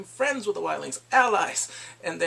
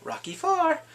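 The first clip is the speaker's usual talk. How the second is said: higher, hammed-up ring call; playful higher boxing-style call; mock announcer; 12 semitones higher than usual. Joking about boxing intro.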